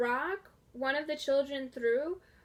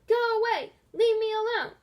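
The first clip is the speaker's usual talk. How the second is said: yelling; 9 semitones higher, 7 dB louder than usual. A narrator's reading. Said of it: "Missus Dodds quit yelling".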